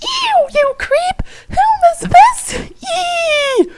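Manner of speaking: squeaky mock disgust, ending in a squeal; disgusted girl. Feferi recoils from Tavros in hammed-up disgust.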